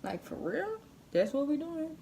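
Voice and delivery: high, stunned disbelief, retold; taken aback. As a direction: pitch jumps up in shocked disbelief at the hit. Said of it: "I was kinda taken aback".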